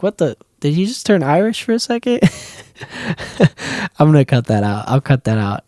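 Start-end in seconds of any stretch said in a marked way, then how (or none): 0.0-2.2 high, softer mock-baffled voice; lightly squeaked mock disbelief; imitating puzzled viewers. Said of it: "You probably were like". 2.8-3.9 soft, high giggle; quiet self-amused chuckle; amused laugh. Real, not acted. Laughs at own accent slip.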